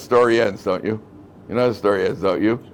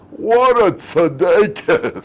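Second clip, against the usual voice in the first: put-on slow, louder, marvelling old lady; awed old lady; 6 semitones higher, 5 dB louder, much slower than usual. Old lady in the back exclaims at the story, slow and awed. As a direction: savour it like an impressed grandmother. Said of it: "she couldn't restrain her".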